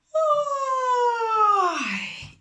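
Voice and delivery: high open yawn sound, loud; demo yawn. Shown as a demonstration. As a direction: stretch it into a big yawn. Yawning to find head voice. (bostezo de demostración)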